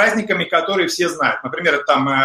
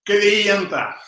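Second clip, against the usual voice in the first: laughing; 2 semitones higher than usual. Real, not acted. Laughs at his own word.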